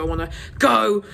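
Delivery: ordinary talk, nothing marked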